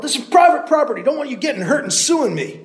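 loud, high shout; yelling. Acted. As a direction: keep hollering it. Landowner keeps yelling.

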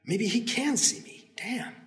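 soft, to himself; hiding hero cursing to himself, soft; quiet aside. Acted. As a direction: mutter it under your breath.

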